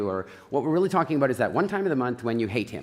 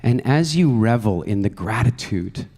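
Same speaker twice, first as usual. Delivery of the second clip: guided meditation; 5 semitones lower, 3 dB louder than usual. Acted. Speaker guiding the audience into a future vision, calm meditation voice. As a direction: ease the room into relaxation.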